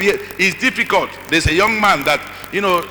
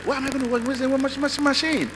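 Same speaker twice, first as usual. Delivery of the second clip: put-on high, fast, whining teen protest; whiny son; 8 semitones higher, 8 dB quieter than usual. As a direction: whine like a spoiled son. Quoting his son's complaint.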